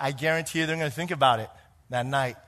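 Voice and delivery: ordinary talk, nothing marked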